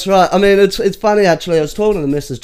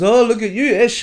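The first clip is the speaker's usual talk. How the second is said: higher, louder teasing quote of his sister; mock teasing; 9 semitones higher, 4 dB louder than usual. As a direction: tease him like a sister. Sister teasing him about bag.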